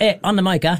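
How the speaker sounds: a Singapore hawker-centre accent, lightly imitated; Singlish. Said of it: "the beauty about Singlish is that they abbreviate everything".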